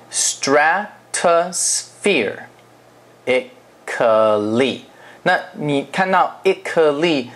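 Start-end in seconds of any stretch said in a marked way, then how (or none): 0.1-4.8 stratosphere, ically said slowly syllable by syllable; slow model word. Shown as a demonstration. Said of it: "是这个字".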